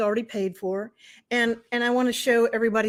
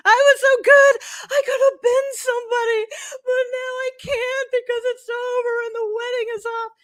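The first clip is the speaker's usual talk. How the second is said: a hammed-up sobbing rant, much higher and louder; sobbing breakdown; 13 semitones higher, 5 dB louder than usual. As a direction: replay the breakdown as a high, loud wail. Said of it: "I start screaming and crying".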